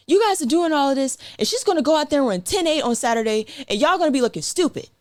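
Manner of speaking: high, fast heated rant; mad coach. Retold or imitated. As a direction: imitate an angry coach. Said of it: "my coach was mad because he's like".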